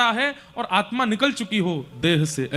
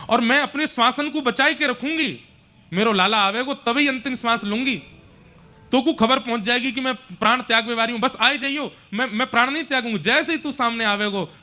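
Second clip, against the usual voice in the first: high, pleading motherly voice; impassioned mother's vow; 5 semitones higher than usual. Acted. Yashoda begs Krishna to return before she dies, impassioned plea. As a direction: pour out an emotional promise, pitched high.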